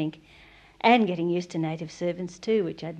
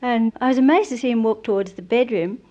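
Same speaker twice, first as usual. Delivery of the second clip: ordinary talk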